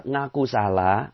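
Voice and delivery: normal talking voice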